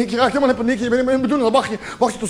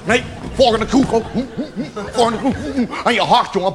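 ordinary talk, nothing marked

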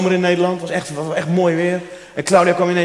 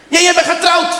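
very high, louder mock scold; scolding cleaner; 13 semitones higher, 4 dB louder than usual. Cleaner scolding after kiss. (heel hoog, gespeeld verwijtend)

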